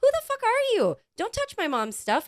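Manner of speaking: high, loud challenge to a stranger; indignant confrontation. Retold or imitated. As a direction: re-enact telling someone off. Retold quarrel over the bag.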